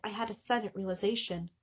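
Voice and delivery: ordinary talk, nothing marked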